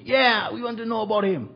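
high, eager villager voice; high eager crowd reply. Retold or imitated. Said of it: "some of them say".

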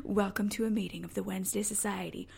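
spooky host. Retold or imitated. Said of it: "they were".

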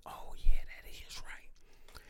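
breathy slow whisper of realisation. Retold or imitated. When the truth finally hits you, slow whisper. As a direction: hush it out slowly like it just dawned on you. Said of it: "And you be like".

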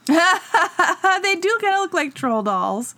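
slow, laughing through the words. Real, not acted. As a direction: chuckle while you agree. Laughing while agreeing.

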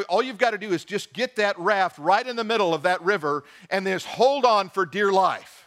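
usual speaking voice

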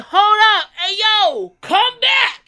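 loud high call after him. Retold or imitated. Calling the catcaller back, loud and high. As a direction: shout him back across the street.

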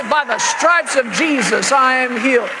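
parent leading a boy in a hammed-up confession; imitated faith-shout; loud confession. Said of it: "Say it out loud".

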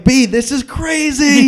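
high, slow, stretched-out wonder; amazed. Retold or imitated. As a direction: savor how crazy it is. Retelling his amazed remark to [name].

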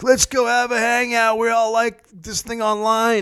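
high-pitched parody of forced enthusiasm; mock eager joiner. Lonely user proposing a hangout, mock eager. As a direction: imitate an over-keen hangout fan, voice way up.

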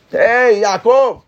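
shouting enemies jeering at the king. Retold or imitated. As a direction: taunt the king from outside the palace. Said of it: "empezaban a gritar".

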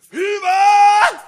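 a big, high chorus yell; crowd-rousing chorus shout. Acted. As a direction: belt the word high and loud.

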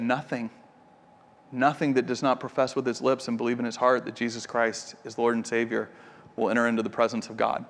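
low, soft and grave, each word set down deliberately; quiet, grave retelling of the father's answer; solemn quiet pronouncement. Retold or imitated. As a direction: hush it like a stern final verdict. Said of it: "And Wade said".

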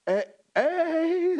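high, loud mock pickup call; smooth flirty hey. Sound man flirting with Katie behind him, hammed-up smooth call. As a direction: call out to her like a smooth operator.

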